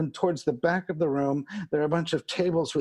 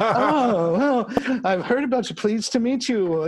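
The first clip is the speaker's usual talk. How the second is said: higher, louder polite fluster; mock flustered greeting; 6 semitones higher, 5 dB louder than usual. Dad meeting Ogre the biker, hammed-up flustered politeness. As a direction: play up a startled, eager hello.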